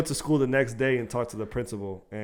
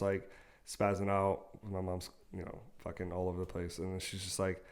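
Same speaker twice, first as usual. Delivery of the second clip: ordinary talk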